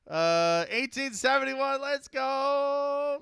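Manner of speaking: high, loud burst of excitement; yelling let's go with real hype; hyped cheer. Real, not acted. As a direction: cheer the raid number like a big win. Hyped reaction to incoming raid.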